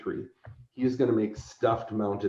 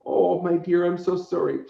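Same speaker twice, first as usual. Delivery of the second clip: put-on old woman voice; 7 semitones higher than usual. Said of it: "old woman sitting on a park bench".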